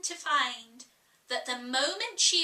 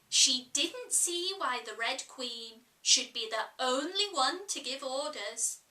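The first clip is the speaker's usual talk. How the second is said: plain reading voice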